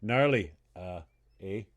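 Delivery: low, dropping Minnesotan "eh"; terse mock Minnesota accent. Co-host showing how Minnesotans say gnarly, Minnesota accent. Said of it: "if you're up in Minnesota".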